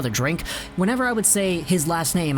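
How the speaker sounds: ordinary delivery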